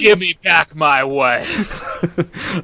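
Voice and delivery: slow, drawn-out mock threat; deliberate, melodramatic demand; movie avenger. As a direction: play the wronged husband, word by word. Playing a vengeful movie husband.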